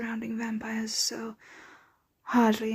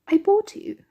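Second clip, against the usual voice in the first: possessive reveal; 10 semitones higher, 7 dB louder than usual. Acted. Reveals listener was bought.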